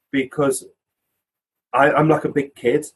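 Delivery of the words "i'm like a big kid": ordinary delivery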